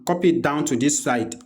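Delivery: usual speaking voice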